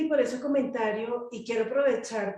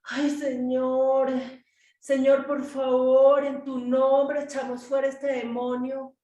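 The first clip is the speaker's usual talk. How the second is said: slow, drawn-out begging plea; mock church prayer, stretched and insistent; imitating pleading prayer; 4 semitones higher, 4 dB louder, slower than usual. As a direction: beg with every word dragged out, higher and louder. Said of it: "escucho a la iglesia orando así".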